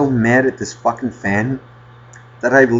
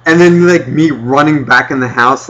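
normal talking voice

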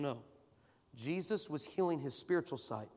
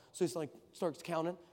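normal talking voice

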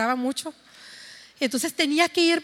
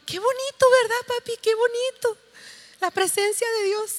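put-on high, bright little girl voice, much higher; child's delighted voice; 8 semitones higher than usual. Mother voicing her daughter, thrilled after praying: high childlike voice.

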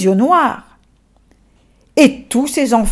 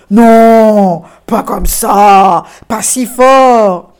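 firm correction; 7 dB louder, much slower than usual. Acted. Nestor correcting the blowing.

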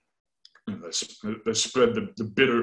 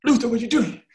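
much higher, incredulous "Oh, Luther"; exasperated scholar; 12 semitones higher, 3 dB louder, faster than usual. Retold or imitated. Oecolampadius watching Luther, exasperated and higher. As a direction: play Oecolampadius throwing up his hands. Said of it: "I'm sure he was just".

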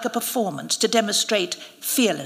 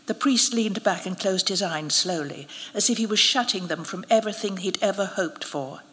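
ordinary delivery, nothing marked

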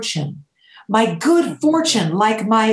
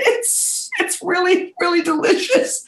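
high, half-whispered tearful voice, laughing and crying; 10 semitones higher than usual. Retold or imitated. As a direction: squeeze it out through mock tears, pitched up. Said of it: "And I started crying".

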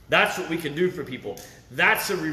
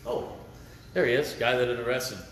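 normal talking voice

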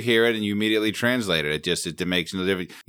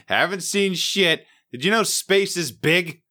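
mock captain's log; 7 semitones higher than usual. Mock starship captain dictating his log.

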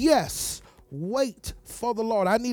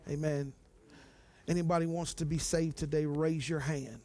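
soft, low prayer voice; 5 semitones lower, 6 dB quieter than usual. Acted. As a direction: pray gently and invite them forward. Soft prayer and altar call.

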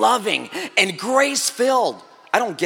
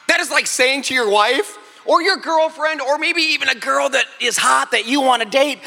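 ordinary talk

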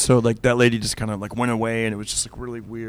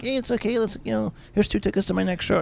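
higher, sweet and breezy; mock gracious reply; 8 semitones higher than usual. Joking reply to the lady's apology, higher and sweet. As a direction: pitch it up, overly nice.

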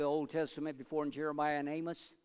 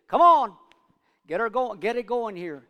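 sudden loud high call to wail louder; hammed-up boss shouting at the mourners; mock barked order; 6 semitones higher, 12 dB louder than usual. The boss urging hired mourners to wail, a mock loud shout.

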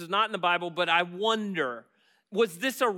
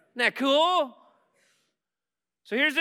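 ordinary talk, nothing marked

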